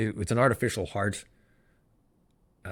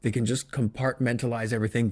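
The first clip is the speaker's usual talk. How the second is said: normal talking voice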